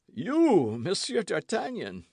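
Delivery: high, startled recognition; lackey amazed to recognise D'Artagnan; astonished cry. A narrator's reading. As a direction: gasp out the name in disbelief. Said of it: "cried Grimaud".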